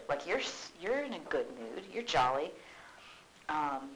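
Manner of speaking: usual speaking voice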